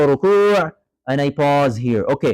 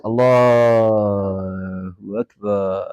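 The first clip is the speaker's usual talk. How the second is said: slow drawn-out takbir; 6 semitones lower, much slower than usual. Shown as a demonstration. Teacher mocking a student's slow takbir, dragged out. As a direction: stretch Allahu Akbar out very slowly. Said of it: "So you're saying I can do this".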